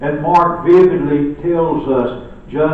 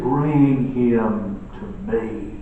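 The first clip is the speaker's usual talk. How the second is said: tender; 4 semitones lower, much slower than usual. Retold or imitated. Compassionate Jesus asking for the boy, slow and tender. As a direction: Bring drawn out slowly and gently. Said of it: "shows his tenderness".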